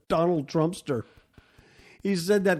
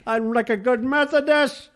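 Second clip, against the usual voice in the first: high, louder mocking imitation of Hillary; 10 semitones higher, 4 dB louder than usual. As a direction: squeak it out like a prim churchgoer.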